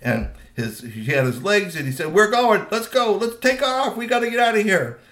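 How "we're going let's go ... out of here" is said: loud, high getaway call; rally the gang to run, raised voice; urgent rallying. A narrator's reading. Teen troll to his gang while fleeing, urgent.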